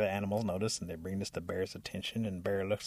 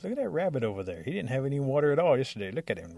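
suspicious accusation; 5 semitones higher, 5 dB louder, faster than usual. Acted. Suspicious animal points out Rabbit.

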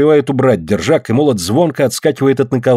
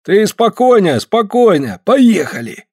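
high, encouraging coaxing; coaxing encouragement; 9 semitones higher, slower than usual. A narrator's reading. Afanasy steadies Andrei before the strike, coaxing encouragement. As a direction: coax him along in a raised pitch.

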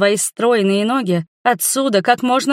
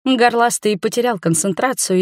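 plain reading voice

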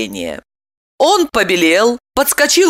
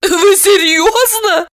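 very high, slow squeaky disbelief; pitched-up incredulous question; astonished woman; 12 semitones higher, 3 dB louder, slower than usual. A narrator's reading. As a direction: squeak it in amazement. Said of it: "поразилась Липочка".

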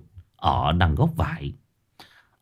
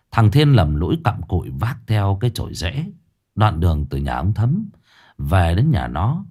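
ordinary delivery

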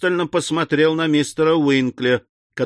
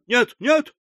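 high-pitched fluttery spinster aunt; high fluster, near hysterics; faltering, flustered; 12 semitones higher than usual. A narrator's reading. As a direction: protest faintly in a high, hysterical voice. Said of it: "пролепетала незамужняя тётушка".

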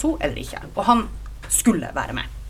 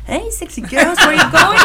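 1 laughing catcall; 11 semitones higher, 7 dB louder, slower than usual. Retold or imitated. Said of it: "kom han og bare".

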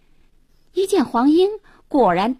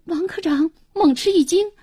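usual speaking voice

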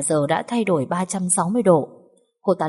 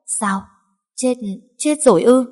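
high, loud stunned question; can't believe she's dead; hoảng hốt, sững sờ; shocked disbelief; 6 semitones higher, 5 dB louder than usual. Acted. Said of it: "với vẻ hoảng hốt".

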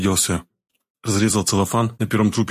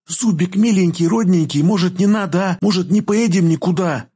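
put-on high-pitched, wailing girl's plea; pleading lament; 10 semitones higher than usual. A narrator's reading. Girl begs her beloved not to go, high pleading lament. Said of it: "запричитала она шёпотом".

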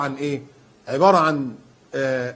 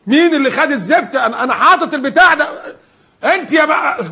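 much higher and louder angry shout; furious yelling; 8 semitones higher, 5 dB louder than usual. Acted. Man yelling at his family when his keys go missing, hammed-up fury. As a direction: yell at the house over a lost thing. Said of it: "يروح جاي لك الشيطان".

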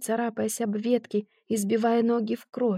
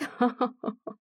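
cruel laugh; 2 semitones higher, 3 dB quieter than usual. Acted. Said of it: "расхохотался".

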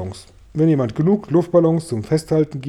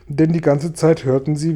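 usual speaking voice

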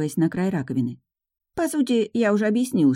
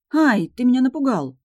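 startled cry; 8 semitones higher, 4 dB louder than usual. A narrator's reading. Startled by sudden dragon.

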